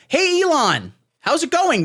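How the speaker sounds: high, bright bro hello; mock chummy greeting. Tucker greets Elon like an old pal, hammed-up. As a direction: sell the buddy act.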